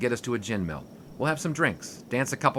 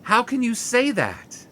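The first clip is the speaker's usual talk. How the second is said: hurt disbelief; 12 semitones higher, 3 dB louder, slower than usual. Acted. Hurt outburst after Fling.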